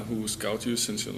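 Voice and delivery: normal talking voice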